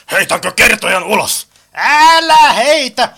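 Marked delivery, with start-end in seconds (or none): 1.7-3.1 high, loud, drawn-out plea; alarmed protest. Acted. Objecting to the narrator being thrown out, alarmed protest. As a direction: cry out to stop it, stretching the words.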